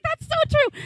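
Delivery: squeaky high imitation of her; high mock agreement. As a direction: pitch way up to echo her. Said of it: "She's like".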